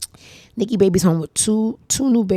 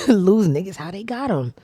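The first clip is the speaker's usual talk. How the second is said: usual speaking voice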